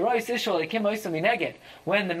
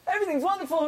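excited; 9 semitones higher, much faster than usual. Real, not acted. Same words repeated.

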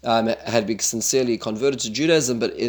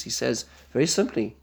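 normal talking voice

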